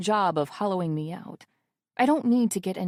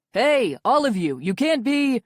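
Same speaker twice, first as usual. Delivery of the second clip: louder, urgent guard's call; panicked shout; 4 semitones higher, 6 dB louder than usual. Acted. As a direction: cut off mid-shout in a panic. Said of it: "a panicked guard shouting".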